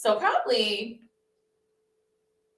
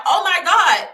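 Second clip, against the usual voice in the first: loud, higher "oh my God"; horrified exclamation; shocked; 9 semitones higher, 9 dB louder than usual. Retold or imitated. Finding the bugs at night, a loud shocked outburst.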